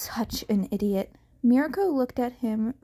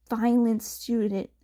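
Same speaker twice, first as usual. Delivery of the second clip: plain reading voice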